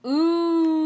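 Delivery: loud high impressed ooh; a chorus of amazed ooh from the crowd; mock crowd going ooh. Crowd reacts to takedown.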